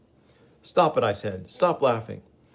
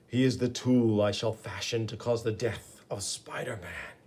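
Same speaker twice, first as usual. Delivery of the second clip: hushed evil mastermind plotting; sinister villain. Acted. Hidden villain schemes in monologue.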